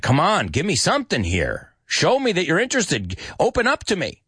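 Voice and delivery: pleading impatience, pitch climbing high; acted exasperated appeal; impatient. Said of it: "he's starting to get impatient".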